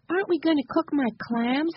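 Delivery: put-on high-pitched child figure; little Benny's voice. A narrator's reading. Benny asking about cooking his clams, high child voice. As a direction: ask in a high little-kid voice.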